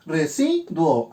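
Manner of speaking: "residuo" slowly, broken as re - si - duo; división silábica. Shown as a demonstration. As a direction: say "residuo" syllable by syllable, duo kept as one. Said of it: "Y hago mi división sílaba". ("residuo" lento y separado: re - si - duo)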